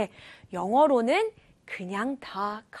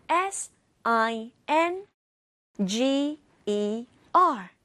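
S I N G E R spelled slowly, letter by letter; over enunciating; slower than usual. Shown as a demonstration. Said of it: "Once more".

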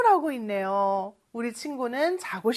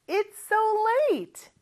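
loud repeat; 10 semitones higher, much slower than usual. Shown as a demonstration. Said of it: "다시 한번 크게 해볼까요".

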